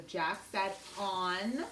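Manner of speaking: slow, word by word dictation. Shown as a demonstration. Teacher dictating a sentence to students, slow and even. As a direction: read each word out slowly. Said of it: "The next word is".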